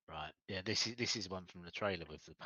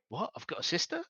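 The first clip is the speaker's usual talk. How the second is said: high, loud startled disbelief; shocked player; 9 semitones higher, 5 dB louder than usual. Retold or imitated. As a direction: gasp out the surprise, pitched way up. Player's shocked reaction, retold.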